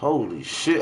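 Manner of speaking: ordinary talk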